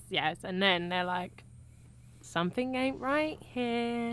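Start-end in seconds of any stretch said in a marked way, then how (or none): 2.2-4.1 slightly put-on, drawn-out higher voice; imitated movie characters. Film fan imitating the pair facing the zombie, lightly put-on. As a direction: lightly imitate dawning suspicion, slowly.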